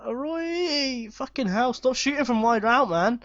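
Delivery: high, loud exasperated yelling; frustrated shout. Real, not acted. Gamer yelling at a player shooting from wide. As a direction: vent at the player who keeps shooting. Shot from distance misses.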